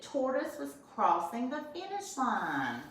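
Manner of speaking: ordinary talk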